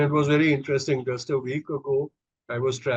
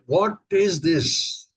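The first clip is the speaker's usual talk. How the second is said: retold agitated customs officer, voiced higher; 5 semitones higher, much slower than usual. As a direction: confront a suspicious traveller, drawn out. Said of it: "he was visibly agitated".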